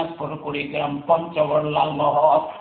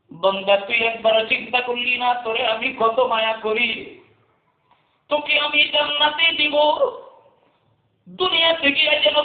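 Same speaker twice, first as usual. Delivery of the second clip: preaching at full force; 8 semitones higher than usual. Acted. Preacher voicing God pleading with His servant, in high chant. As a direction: cry out, then plead high and long.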